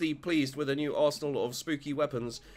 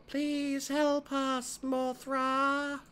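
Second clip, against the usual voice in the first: much higher, very slow, eerie; slow high creepy twin voice; 10 semitones higher, much slower than usual. Acted. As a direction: plead like ghost children. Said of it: "creepy twin shtick".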